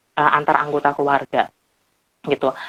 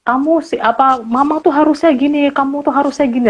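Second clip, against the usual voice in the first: high, whiny blaming voice; accusing you message; 10 semitones higher than usual. Retold or imitated. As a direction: point the finger at mom, pitched way up. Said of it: "Kalau you message itu". (contoh you message yang menuding, merengek)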